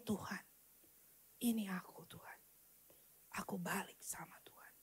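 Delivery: very soft, low whisper to God; whispered prayer. Retold or imitated. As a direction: whisper a humble return to God. Retold prayer of surrender. (doa berbisik penuh penyerahan)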